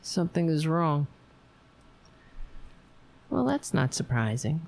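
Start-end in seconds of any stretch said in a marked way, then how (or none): none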